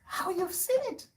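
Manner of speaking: high, soft incredulous question; acted disbelief, hushed and high; astonished grandchild. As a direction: pitch it right up in mock amazement. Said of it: "And I'll be like".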